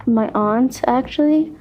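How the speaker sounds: ordinary delivery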